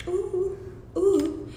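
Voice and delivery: usual speaking voice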